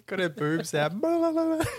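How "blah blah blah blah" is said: mock babble. Real, not acted.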